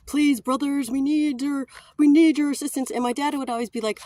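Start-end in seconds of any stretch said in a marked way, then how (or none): 0.1-2.9 higher, louder pleading plea for money; begging for donations. Retold or imitated. Speaker begging the brothers for donations, pleading louder. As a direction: beg the crowd like a convention speaker. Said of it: "begging for donations".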